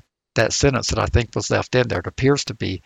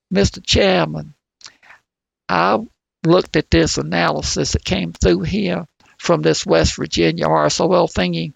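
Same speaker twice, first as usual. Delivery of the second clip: a mild put-on West Virginia coal miner accent, pitched higher; a rough imitation of an old West Virginia coal miner; 5 semitones higher than usual. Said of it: "I apologize for my horrible accent".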